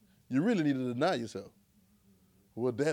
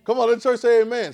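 mock call and response; 6 dB louder than usual. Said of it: "I like church like this".

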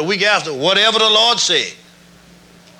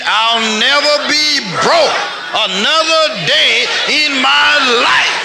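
with full conviction; 5 semitones higher, slower than usual. Shown as a demonstration. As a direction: declare it slowly, every word landing. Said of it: "Say it like you mean it".

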